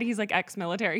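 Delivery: normal talking voice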